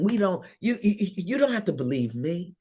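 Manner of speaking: ordinary delivery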